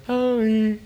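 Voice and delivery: imitating a two-year-old mumbling sorry; a light toddler voice; toddler's mumbled sorry. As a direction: do the little one's half-hearted "Oh". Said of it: "that's how he says it".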